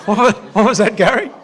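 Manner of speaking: asking through real laughter; laughing while calling out to Gary. Real, not acted. Preacher laughing as he asks Gary to repeat. Laughing at Gary's joke from congregation.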